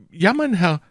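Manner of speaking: high, timid shopkeeper's reply; trembling. A narrator's reading. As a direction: answer the official nervously, pitched high. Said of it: "antwortete der Krämer mit Zittern".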